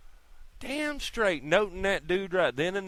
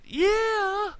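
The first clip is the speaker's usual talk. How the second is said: very high, loud cheering yell; hype shout; 4 dB louder than usual. Real, not acted. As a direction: whoop it out like a fan. Hyping up Gilroy's name.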